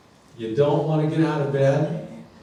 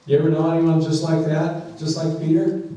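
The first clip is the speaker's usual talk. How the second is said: normal talking voice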